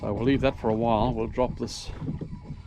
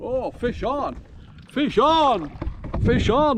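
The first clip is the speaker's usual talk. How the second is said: excited shout; 10 semitones higher, 4 dB louder, slower than usual. Real, not acted. Catching a fish, calling out.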